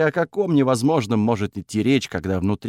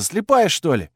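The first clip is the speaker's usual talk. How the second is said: put-on higher, sharp young woman; snappy girl voice; 6 semitones higher than usual. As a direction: snap it like an annoyed girl. Girl snaps after collision.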